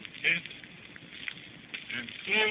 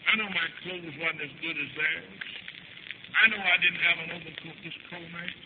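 sorrowful confession; 6 dB quieter than usual. Retold or imitated. Child tells mother of family poverty, sorrowful confession. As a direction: confess it quietly, heavy with hurt.

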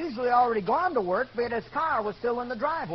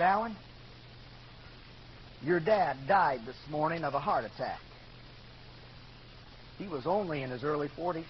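low, softening, solemn voice; grave, gentle news-breaking; 7 semitones lower, 8 dB quieter than usual. Retold or imitated. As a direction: break it softly, with long pauses. Preacher gently breaks death news.

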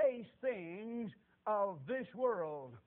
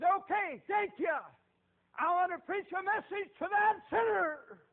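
high, loud, slow crying call; loud, high, tearful-edged street preacher call; preaching yell; 10 semitones higher, 10 dB louder, slower than usual. Retold or imitated. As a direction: call out across the field. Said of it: "yelling and hollering at him".

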